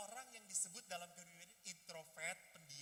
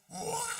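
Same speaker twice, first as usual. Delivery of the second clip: loud "wah" bursting into guffaws; hammed-up guffaw; 11 dB louder than usual. Teacher showing people who laugh loudly, mock guffaw. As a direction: roar with exaggerated belly laughter. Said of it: "terbahak-bahak gitu ya".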